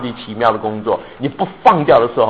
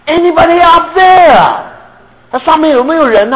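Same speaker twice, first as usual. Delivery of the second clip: high, loud and slow, calling upward; shout for help; 13 semitones higher, 7 dB louder than usual. Retold or imitated. Man hanging from a branch shouts up for help. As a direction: shout it up a cliff face, desperate. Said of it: "所以他大声叫".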